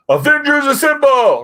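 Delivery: mock heroic battle cry; high, loud, drawn-out rallying yell; Captain America.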